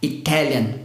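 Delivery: "Italian" with stress on "tal", T sounded; stressed true T. Shown as a demonstration. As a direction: lean on the second syllable of "Italian". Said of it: "por causa da tônica".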